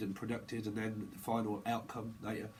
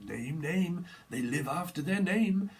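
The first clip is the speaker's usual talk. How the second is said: mock confusion; 5 semitones higher, 3 dB louder, slower than usual. Mimicking confused people.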